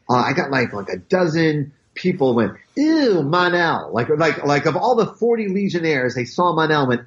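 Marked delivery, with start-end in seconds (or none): 2.8-3.9 higher, drawn-out imitated "ew"; playfully grossed-out quote; mock disgust. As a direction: slowly mimic disgusted fans. Imitating fans' disgusted reaction.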